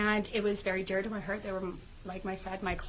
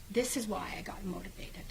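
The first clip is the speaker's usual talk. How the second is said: soft unvoiced whisper of realization; 4 dB quieter than usual. Real, not acted. Guest to host, whispering her realization of her own worth. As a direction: breathe it out in a whisper.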